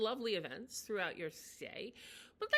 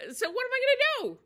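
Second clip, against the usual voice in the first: high, loud exasperated cry; hammed-up panic about the raccoon; mock panic; 10 dB louder than usual.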